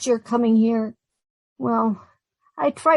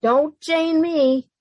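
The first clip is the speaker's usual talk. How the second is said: sharp rebuke; 6 semitones higher, much slower than usual. Acted. Jane snaps at Irma, slow and cold. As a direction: cut her off, slow and cold.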